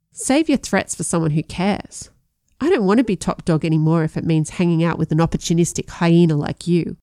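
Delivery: usual speaking voice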